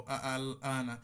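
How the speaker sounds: ordinary talk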